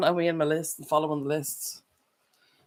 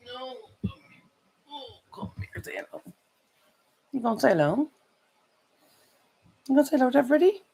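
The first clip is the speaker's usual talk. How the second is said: pet talk; 10 semitones higher, faster than usual. Real, not acted. Coaxing her puppy to say hello, high baby talk. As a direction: coax the dog up high and sweet.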